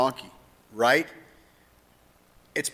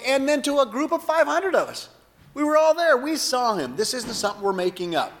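raised, earnest witness voice; voicing an apostle; 10 semitones higher than usual. Preacher voicing Paul telling the crowd he saw him. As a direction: testify like an eyewitness. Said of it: "And then he said".

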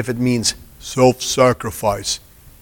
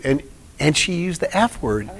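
ordinary talk, nothing marked

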